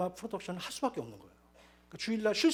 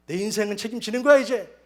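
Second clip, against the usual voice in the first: louder, higher burst of glee; excited inner thought; 6 semitones higher, 10 dB louder than usual. Retold or imitated. Tempted man, tipsy from drink, cheering himself on, excited. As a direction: ring out as if the deal were already won.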